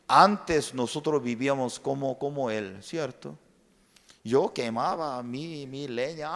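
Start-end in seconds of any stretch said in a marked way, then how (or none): none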